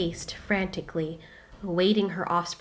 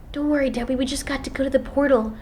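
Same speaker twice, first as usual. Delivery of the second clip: put-on higher, eager boy's voice; young hero Kaleido; 7 semitones higher, 4 dB louder, faster than usual. Boy hero reassuring Demby about the portal, eager. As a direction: reassure the friend, keen to get home.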